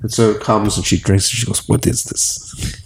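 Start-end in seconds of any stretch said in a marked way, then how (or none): 1.7-2.8 slow, whispered, amazed; slow surprised whisper. Retold or imitated. Grandson voicing grandmother tasting the limoncello spritz, slow whisper. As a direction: hush it out as the first sip lands.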